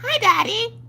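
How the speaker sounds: put-on high sweet child greeting; little girl voice. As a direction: chirp it like a small girl. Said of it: "She's like".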